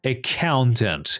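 Accountant as ac-COUN-tant, slowly; slow model of Accountant with the middle stressed; stress on second syllable. Shown as a demonstration. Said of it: "باید ما روی سیلاب درستش".

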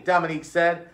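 ordinary delivery, nothing marked

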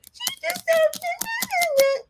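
very high, very slow fake wail; hammed-up high crying mock voice; mock sobbing. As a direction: drag it out in a squeaky fake cry. Mocking someone's emotional reaction.

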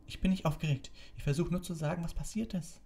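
high-pitched, frustrated protest; angry. A narrator's reading. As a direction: insist crossly on being heard. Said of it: "sagte Harry zornig". (zornig, mit hoher Stimme)

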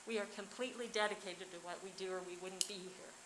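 normal talking voice

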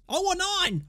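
P=A; hyped demand. Real, not acted. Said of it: "Let's spank these guys".

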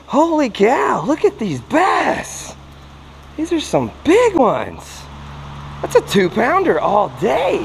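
can't believe the size of these fish; thrilled. Real, not acted. Finds a spot full of big bass.

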